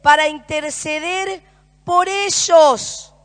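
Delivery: loud, impassioned sermon delivery; fervent pulpit proclamation, driving every phrase; preaching at full force. Acted. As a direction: preach it out to the whole room. Preaching to the congregation.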